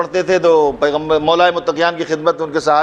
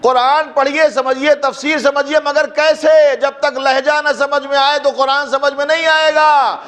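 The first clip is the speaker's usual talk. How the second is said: impassioned preaching climax; 11 semitones higher than usual. Real, not acted. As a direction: build to a rousing, chanted pitch for the congregation. Preacher builds to rousing climax.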